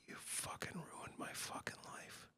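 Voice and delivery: very soft whispered quote; whispered accusation. Retold or imitated. Woman confronting the man who used her, whispered. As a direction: whisper it, imitating a hurt accuser.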